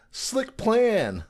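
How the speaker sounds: high-pitched dopey character quote; dumb one. Retold or imitated. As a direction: imitate the dim one admiring a plan. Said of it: "got the dumb one to come".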